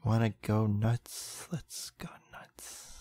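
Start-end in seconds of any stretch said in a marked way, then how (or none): 1.5-2.9 hushed, slow egging-on; conspiratorial playful whisper; mock whisper. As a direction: whisper it slowly, barely audible.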